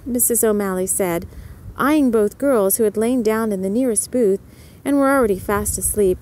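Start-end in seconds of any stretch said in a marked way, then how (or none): none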